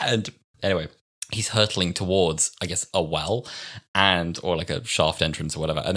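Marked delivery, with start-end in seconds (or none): none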